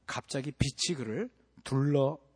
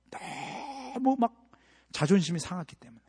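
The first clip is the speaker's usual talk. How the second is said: choked up; 6 semitones higher, slower than usual. Real, not acted. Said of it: "voice breaks in sermon".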